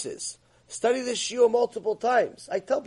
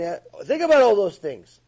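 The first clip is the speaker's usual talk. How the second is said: usual speaking voice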